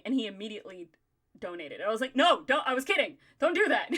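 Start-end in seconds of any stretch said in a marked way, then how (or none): 2.2-3.9 loud, high-pitched backpedaling; retold flustered plea, pitched up; mock panicked protest. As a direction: hastily call off a joke, voice raised. Retold panic after joke taken seriously.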